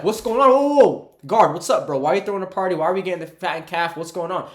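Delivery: loud, high indignant outburst; mad older brother. Retold or imitated. Brother confronting a guard about the party, mad and loud. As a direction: play the outraged brother demanding answers. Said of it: "He was mad".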